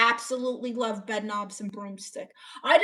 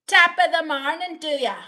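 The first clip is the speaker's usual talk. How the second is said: high, loud, fast attempt at Irish gone Scottish; Irish turning Scottish; 5 semitones higher, 5 dB louder, faster than usual. Streamer retrying Irish greeting, accidental Scottish accent. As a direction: botch an Irish greeting so it lands in Scotland. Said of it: "now it's like Scottish".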